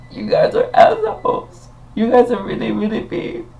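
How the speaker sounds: tiny, very high wounded voice; mock hurt. Mock hurt reply to guests.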